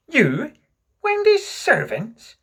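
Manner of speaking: very high, loud incredulous questions; high-pitched, scandalised echo; appalled disbelief. A narrator's reading. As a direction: draw it out in outrage. John appalled at being servants.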